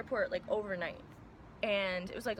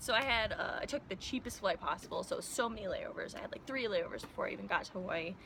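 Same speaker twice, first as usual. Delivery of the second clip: ordinary talk, nothing marked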